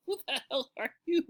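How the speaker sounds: high laughing who-are-you; laughing retort. Retold or imitated. Freshman answering the tall bully, laughing high retort. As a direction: laugh through the comeback. Laughing while retelling his reply.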